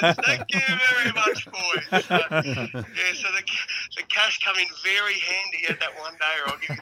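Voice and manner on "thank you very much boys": slow, laughing, gloating thank-you. Retold or imitated. Cashing in on the bus, laughing smug thanks. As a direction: savour the win with a laugh.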